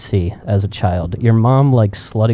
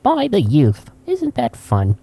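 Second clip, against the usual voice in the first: old-timey grandpa impression, high opening; imitated old man, pitch falling away; 1920s grandfather; 5 semitones higher, slower than usual. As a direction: marvel like an old-fashioned grandfather. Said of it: "nineteen twenties grandfathers".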